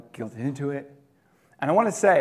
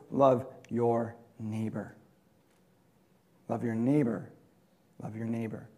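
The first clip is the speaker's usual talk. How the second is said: gentle repeated command; 6 semitones lower, 5 dB quieter than usual. Retold or imitated.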